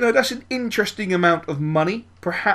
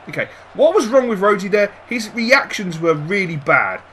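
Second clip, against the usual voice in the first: ordinary talk, nothing marked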